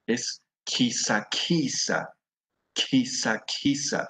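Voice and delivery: "quisaquisa" slow, each syllable given its full length; teacher models "quisaquisa" slowly for the class; modelled word. Shown as a demonstration. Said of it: "Es un arbusto".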